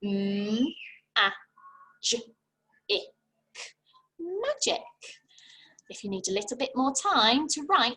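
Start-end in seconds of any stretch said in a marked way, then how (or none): 0.0-4.8 cheerful teacher blend; sing-song blended word. Shown as a demonstration. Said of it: "I'll sound it out again".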